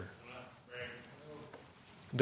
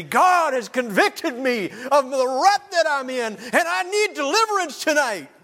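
very high, loud, slow confession; crying out; 7 dB louder, slower than usual. Pretend. Congregant standing up mid-sermon, crying out high and loud. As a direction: cry out your need before everyone. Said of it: "you're gonna stand up and say".

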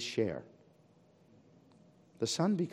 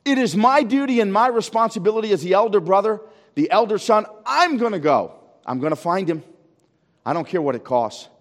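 raised, higher voice vowing to go; loud determined vow; 6 semitones higher, 11 dB louder than usual. Acted. Preacher voicing the elder son's loud vow. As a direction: shout it as the dutiful elder son.

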